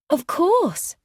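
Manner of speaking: high, bright exclamation; eager cry. A narrator's reading. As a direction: jump in eagerly. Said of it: "cried Jennifer".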